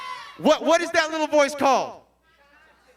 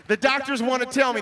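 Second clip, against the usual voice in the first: ordinary talk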